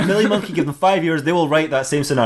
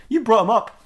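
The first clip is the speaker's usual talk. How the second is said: high-pitched indignant accusation; accusing; 9 semitones higher than usual. Acted. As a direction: throw the blame back, pitched well up. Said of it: "And he goes".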